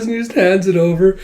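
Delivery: ordinary delivery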